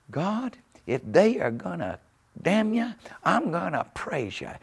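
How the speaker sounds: high, drawn-out imitated holler; hollered praise. Preacher retells a man hollering out to God, hollering. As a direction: holler it skyward, well above normal pitch. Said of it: "and he hollers out".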